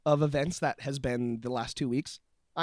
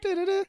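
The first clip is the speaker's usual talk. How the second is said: high alert jingle. Retold or imitated. Joins the alert imitation.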